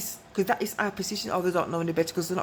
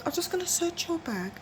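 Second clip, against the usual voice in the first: sugary polite; 8 semitones higher, 4 dB quieter than usual. Retold or imitated. Polite voice to rude customers.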